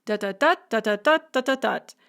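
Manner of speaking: galloping dut rhythm with a strong last beat; anapest rhythm. Shown as a demonstration. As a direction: hum weak-weak-strong. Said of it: "It goes".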